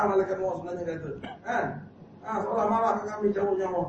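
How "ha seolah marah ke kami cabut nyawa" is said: ordinary talk, nothing marked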